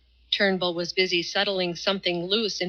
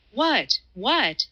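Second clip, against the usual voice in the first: urgent cry; 4 semitones higher than usual. A narrator's reading. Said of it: "cried the other".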